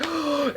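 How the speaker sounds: high, soft sharp gasp; 高音轻声倒吸一口气. Retold or imitated. Staring at the baby.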